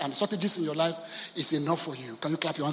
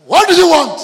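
a sharp, much higher and louder what do you want; annoyed phone answer; 10 semitones higher, 18 dB louder than usual. Retold or imitated. A father snapping at a phone call: loud, high. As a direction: bark it down the phone at the caller.

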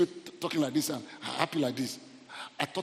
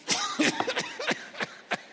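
high, wheezy burst of laughter; laughing. Real, not acted. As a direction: crack up at your own joke. Laughs after teasing Ijebu people.

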